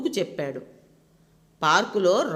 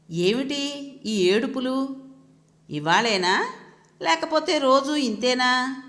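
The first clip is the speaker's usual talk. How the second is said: slow, higher, sharply disapproving question; daughter-in-law frowning at the crying kids, slow and disapproving; frowning disapproval; 4 semitones higher, slower than usual. Acted. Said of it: "నొసలు చిట్లించి అంటున్న".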